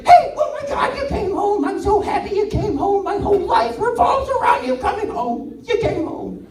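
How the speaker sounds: high, loud, bubbling with joy; overjoyed dog. Pretend. Dog greeting owner at the door, high and overjoyed. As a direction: gush like a dog seeing its owner return. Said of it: "your dog's like".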